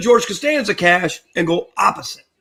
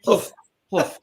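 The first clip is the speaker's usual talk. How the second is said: mumbled quote; 6 dB quieter than usual. Retold or imitated. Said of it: "He goes".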